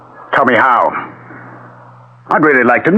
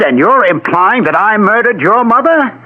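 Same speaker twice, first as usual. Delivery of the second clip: indignant; 8 semitones higher than usual. Acted. Husband indignant at accusation.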